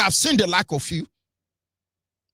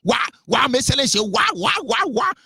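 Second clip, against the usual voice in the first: high, sing-song mocking babble; mocking Yoruba chatter; 6 semitones higher than usual. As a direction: parody the chatter with a lifted, bouncing pitch. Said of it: "They can't talk without saying".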